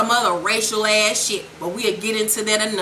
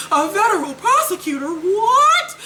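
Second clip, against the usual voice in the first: mock astonishment; 9 semitones higher than usual. Ghost learns she's a prosecutor, mock astonished squeal. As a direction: build to a squealed, incredulous question.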